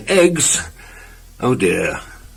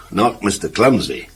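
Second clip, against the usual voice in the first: usual speaking voice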